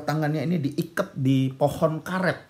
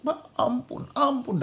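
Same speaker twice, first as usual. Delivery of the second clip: put-on high, feeble child's plea; pained, half-delirious; 11 semitones higher, slower than usual. Tortured son still begging his father, pained delirious plea. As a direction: beg as if drifting off in pain. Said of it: "dalam kesakitan, setengah ngigo".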